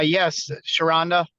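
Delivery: usual speaking voice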